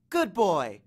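firm praise to a dog; dog-command praise. A narrator's reading. Annabeth to the monster dog, commanding praise. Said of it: "the same tone she was using on the dog".

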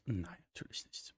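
dry whispered aside; whispered sarcasm. Real, not acted.

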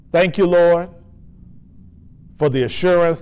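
ordinary talk

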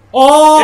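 very loud, high cry of dismay; shocked yell. Real, not acted. Player hit mid-match, shocked yell. Shock at being killed in game.

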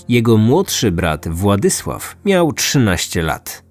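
plain reading voice

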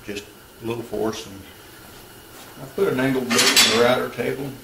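ordinary delivery

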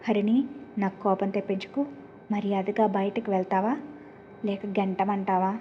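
plain reading voice

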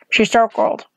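usual speaking voice

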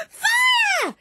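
high, loud scream of Fire; very high, loud shriek of alarm; shouted Fire. Acted. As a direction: scream for help from the window. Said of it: "every time she shouted".

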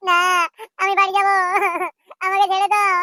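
high, hammed-up crying plea; tearful wail. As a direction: wail to be let go, sobbing between phrases. Captive child begs to go home.

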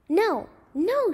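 high, swooping cry of protest; storyteller's high-pitched shouted plea; desperate shout. A narrator's reading. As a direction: cry out to stop him. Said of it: "shouted Aaron".

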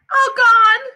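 put-on high, loud little-kid voice; toddler wail. A narrator's reading. Little brother telling Mommy about his teeth, high loud wail. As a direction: announce it as a wailing small child.

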